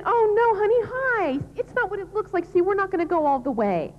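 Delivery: hammed-up panicky spouse; caught cheating spouse. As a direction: pitch it up and blurt the excuse to the stunned partner. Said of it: "they roll over and say".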